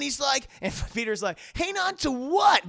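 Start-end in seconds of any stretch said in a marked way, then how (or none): 1.5-2.7 high, loud, stretched-out question; hammed-up incredulous shout, slowly; mock alarmed yell. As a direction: yell back in comic panic. Peter yells back, alarmed.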